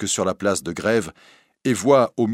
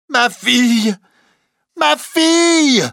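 very high, loud scream, mounting; overwhelmed cry; 8 dB louder, slower than usual. Acted. Recluse recognising her daughter, crying out. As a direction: cry out, climbing higher and longer. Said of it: "crie la recluse".

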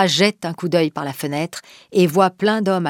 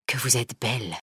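a low, softer weak voice; faint murmur; 6 semitones lower, 9 dB quieter than usual. Acted. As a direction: marvel at her, barely able to speak. Dying man sees Margot.

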